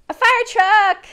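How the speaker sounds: high, loud eager answer; excited. Real, not acted.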